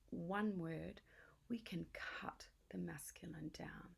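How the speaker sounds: slow breathy whisper, leaning in. Real, not acted. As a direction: hush it like a secret to a friend. Conspiratorial aside to co-host.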